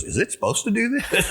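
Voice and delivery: high, laughing alarm as the son; squeaky laughing panic, imitating the son; son's alarmed question. Son sees press spewing wine.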